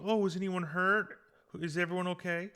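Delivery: high-pitched imitation of a kindly old lady; very nice old lady. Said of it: "the very nice old lady".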